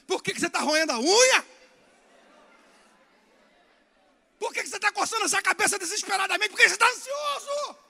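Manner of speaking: loud, high preaching quote; loud exhortation. Acted. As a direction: shout the questions at the neighbour. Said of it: "Fala pra ele". (pergunta gritada, mais aguda e alta)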